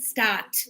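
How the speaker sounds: "Start" with the end T released clearly; final sound shown. Shown as a demonstration. Teacher asking about the end sound, clear T. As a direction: let the T ending "Start" be heard. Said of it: "what's the sound you can hear".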